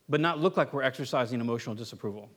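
ordinary talk, nothing marked

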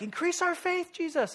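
high, whining ask; mock-whiny disciples; whiny plea. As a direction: beg like a needy child.

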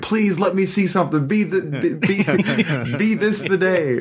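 high, pleading ghost hunter call; ghost hunter plea. Retold or imitated. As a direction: beg the spirits to show up, pitched high, half laughing. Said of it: "You, you went full Bagans".

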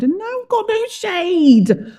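high-pitched wail of complaint; groaning. A narrator's reading. Jonah has lost his shade, groaning. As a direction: whine it high, drawing out the grievance. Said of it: "he groaned".

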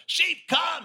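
high, loud holler to the flock. Retold or imitated. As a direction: shout it out to distant sheep. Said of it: "No, louder".

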